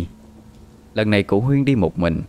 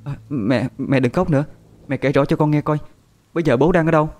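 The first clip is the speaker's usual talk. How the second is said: higher, anxious questioning; urgent concern; 6 semitones higher than usual. A narrator's reading. Daughter asking crying mother where father is, urgent concern. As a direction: press gently but urgently for the facts.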